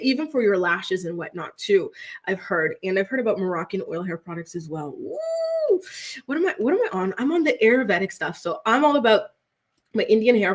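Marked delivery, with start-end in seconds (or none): 5.0-5.8 high-pitched drawn-out "woo"; airy high whoop. Real, not acted. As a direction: let out a long, high, soft whoop. Exclaims mid-chat about hair products.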